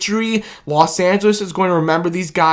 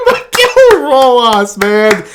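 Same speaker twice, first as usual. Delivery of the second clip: slow, louder, higher name call; drawn-out hype; 6 semitones higher, 5 dB louder, much slower than usual. Real, not acted. Fan hailing the ninth-inning hero: drawn-out excited call. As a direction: stretch the hero's name in awe.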